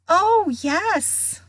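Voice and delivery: high, overdone agreement; lying enthusiasm. A narrator's reading. Friends assuring their ghost host, falsely keen. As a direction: agree too eagerly. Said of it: "they lied".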